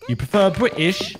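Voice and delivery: high, exaggerated British accent. Shown as a demonstration. Streamer teasing about their accent, hammed-up British. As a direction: put on a playful, high-pitched British accent. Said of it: "when I do my British accent".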